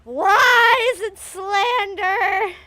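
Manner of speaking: loud, high, drawn-out protest; mock outraged cry. Joking accusation thrown at a co-reader, loud mock outrage. Mock outcry amid the banter.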